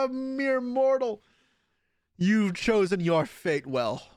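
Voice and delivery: high, slow mock-grand villain; overdone dark lord taunting the opponent. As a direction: lord it over the mere mortal. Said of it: "am I overdoing it".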